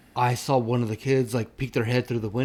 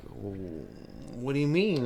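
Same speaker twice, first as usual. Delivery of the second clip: scared; 6 dB quieter, much slower than usual. Retold or imitated. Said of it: "that made me like".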